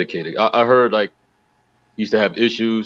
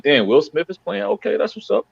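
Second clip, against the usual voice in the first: excited; 10 semitones higher than usual. Retold or imitated. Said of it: "I'm like".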